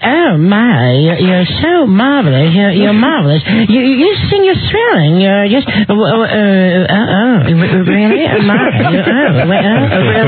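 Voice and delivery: slurred, stammering diva gushing. Retold or imitated. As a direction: play a tipsy old star gushing at her fans. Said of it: "You like it when she's".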